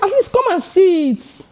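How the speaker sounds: very high, retold pleading; acted high-pitched exasperation; exasperated plea. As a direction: pitch it way up, urging a lazy assistant. Narrator pleading with idle assistant.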